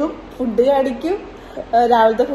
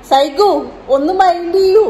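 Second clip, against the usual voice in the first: calling out to son; 8 semitones higher, 6 dB louder than usual. Real, not acted. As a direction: call Saigu over to pay attention. Mother calls son for attention.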